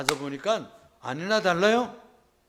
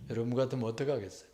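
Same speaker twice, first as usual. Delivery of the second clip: ordinary talk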